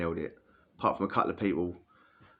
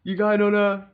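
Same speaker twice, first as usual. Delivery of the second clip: much higher, louder mock pal voice; imitating the pal; 10 semitones higher, 6 dB louder than usual. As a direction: bark it as the rowdy cellmate pal.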